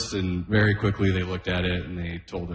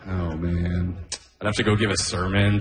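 normal talking voice

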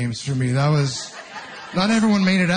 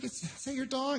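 put-on sweet talk to toddler; 7 semitones higher, 13 dB quieter than usual. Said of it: "I said".

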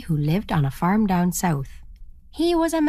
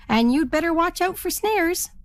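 warning spruce hen; 9 semitones higher than usual. A narrator's reading. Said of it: "said the spruce hen".

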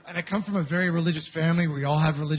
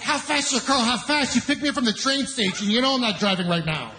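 mock kid interrogation; 6 semitones higher than usual. Kid grilling him, imitated.

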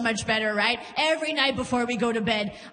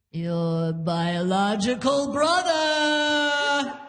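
slow, drawn-out, hammed-up instructor; tortured acting instructor; much slower than usual. Instructor sets a scene for acting class, tortured slow delivery. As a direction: stretch out each phrase as a tortured drama teacher. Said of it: "one tortured soul of an instructor".

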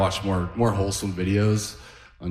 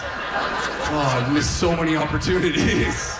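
high-pitched, hammed-up lament; mock weeping; 7 semitones higher, slower than usual. Comic overwhelmed by his watch, mock-weeping lament. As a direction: wail with comic regret, pitched up. Callback to weeping granddad's wail.

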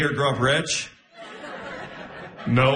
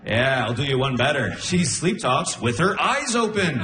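ordinary delivery

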